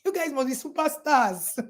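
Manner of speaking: ordinary delivery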